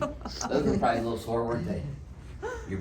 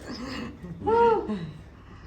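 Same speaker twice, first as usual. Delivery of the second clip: startled laugh; 13 semitones higher than usual. Real, not acted. Patient laughs at adjustment.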